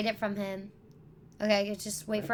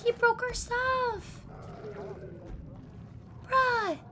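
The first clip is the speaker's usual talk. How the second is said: high-pitched dismay; 11 semitones higher, 4 dB quieter, much slower than usual. Real, not acted. Dismay at broken loot.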